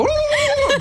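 high turkey call imitation; turkey gobble reply. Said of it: "they'd all go".

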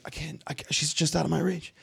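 very soft, high imitated plea; mock helpless plea. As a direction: play helplessly out of reach, barely audible. Acts out man reaching for her.

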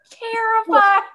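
high, loud exclamation; mock alarm. Friend recalling her reaction to the scared face, mock alarm.

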